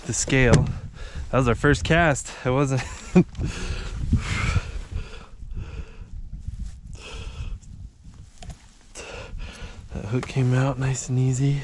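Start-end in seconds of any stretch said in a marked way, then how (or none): 2.9-7.9 silent wheezing laugh; breathy laugh. Real, not acted. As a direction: laugh under your breath. Joke about first cast.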